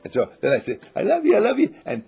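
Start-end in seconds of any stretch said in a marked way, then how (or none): 1.0-1.7 loud, high gushing "I love you"; loving coo. Shown as a demonstration. Gushing love to a seed in the dirt, loud and high. Cooing, laughing.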